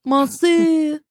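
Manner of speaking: Monsieur in a French accent. Shown as a demonstration. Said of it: "in French or whatever".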